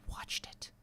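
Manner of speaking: eerie hushed confession; creepy whisper. Acted. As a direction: whisper it like a dark secret. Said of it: "sorry to get a little creepy on you guys".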